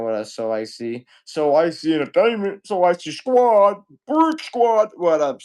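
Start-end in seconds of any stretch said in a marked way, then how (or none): 1.2-5.4 loud, high crew chant, climbing higher; hyped shout-out. Pretend. Streamer greets a viewer by riffing on the name, hyped shout-out. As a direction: call the squad out loud and high, drawing it out.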